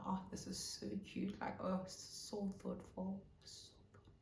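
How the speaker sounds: hushed, airy gushing; touched cooing. Retold or imitated. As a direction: melt softly over a thoughtful gift. Acted touched reaction to flowers.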